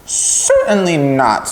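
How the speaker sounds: a proud lamb turning up its nose; haughty lamb. Acted. Lamb snubbing the lonely pig, haughty and high.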